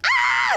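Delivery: very high mock scream; imitated panicked scream. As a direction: squeal like a frightened mom watching. Mom screaming on the side.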